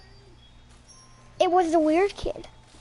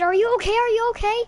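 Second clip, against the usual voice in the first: worried calling; 7 semitones higher, 7 dB louder, faster than usual. Retold or imitated. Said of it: "I ran to her and said".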